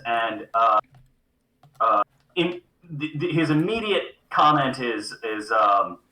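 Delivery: ordinary talk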